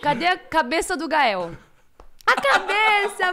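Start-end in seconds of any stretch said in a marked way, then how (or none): 2.3-3.3 put-on baby voice. Answers for baby, child voice.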